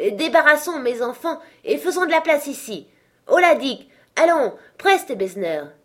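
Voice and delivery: high, loud brisk orders; rally everyone with hearty calls; jovial calling out. A narrator's reading. Fezziwig having the shop cleared for dancing, jovial calls. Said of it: "s'écria le vieux Fezziwig".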